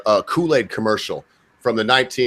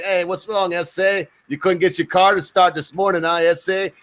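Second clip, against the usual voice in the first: higher-pitched imitation Mexican accent; lightly raised Mexican-accented ribbing; mock Mexican accent; 5 semitones higher than usual. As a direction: tease a late workmate in a put-on Mexican accent. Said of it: "the Mexicans be like".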